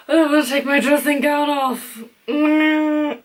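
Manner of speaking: whiny, put-on moaning voice; mock whine. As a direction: moan about taking the gown off. Whiny complaint about cold.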